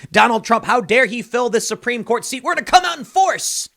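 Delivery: hammed-up indignation, climbing high; mock outrage. Commentator mimics voters vowing revenge on Trump, mock outraged. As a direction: imitate outraged protesters, pitch rising to a peak.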